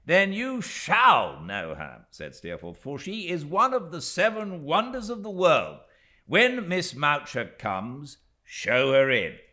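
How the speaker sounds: loud, high, relishing the joke; amused, grand. A narrator's reading. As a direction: announce her like a marvel. Steerforth amused, ordering Littimer.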